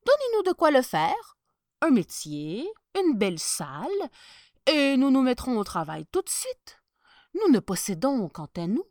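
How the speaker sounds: cunning tailor explaining; sly tailor. Acted. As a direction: sell the lie with charm. Tailor asks for materials.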